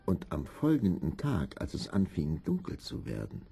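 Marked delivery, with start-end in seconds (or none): none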